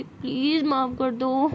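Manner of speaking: slow, whiny begging; drawn-out pleading. Acted. Siya promising a public apology to Tara, begging. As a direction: stretch the plea out to win forgiveness.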